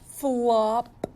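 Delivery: usual speaking voice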